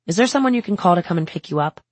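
fast, hurried intern question; busy intern, rushed. Acted. Intern hurrying a patient, fast rushed question.

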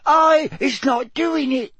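terrified, very high squeal; Winky. A narrator's reading. The elf denies the spell to Mister Diggory, terrified squeal. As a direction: squeal it slowly in panic. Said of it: "she squealed".

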